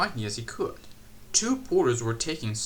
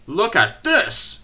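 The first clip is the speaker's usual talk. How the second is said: loud, slow, higher angry shout; 7 semitones higher, 12 dB louder, slower than usual. A narrator's reading. Controller shouts at Percy over the damage, loud. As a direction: bellow it at the engine.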